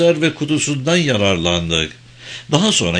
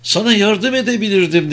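high-pitched imploring woman; woman pleading to come along; pleading companion; 10 semitones higher than usual. Acted. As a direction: press the plea in a higher voice. Same woman keeps pleading.

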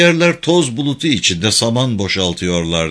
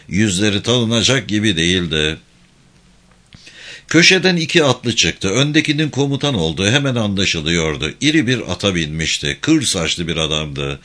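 plain reading voice

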